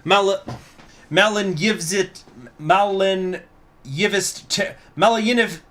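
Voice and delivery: put-on high, forceful accented speech; politician's impassioned, accented address; Bush voice. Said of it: "while doing a Bush voice".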